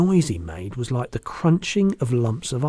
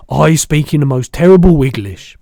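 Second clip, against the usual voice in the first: loud, higher giant lament; rueful BFG; 4 semitones higher, 12 dB louder than usual. A narrator's reading. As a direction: confess it loudly as a giant. Giant laments his bad speech.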